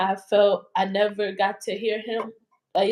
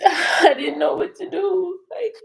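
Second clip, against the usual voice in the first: real tears breaking through, slow and higher; choked up while laughing through it; crying; 7 semitones higher, 6 dB louder than usual. Real, not acted. Telling a friend she didn't know what to do, real crying. Recalling hearing of his shooting.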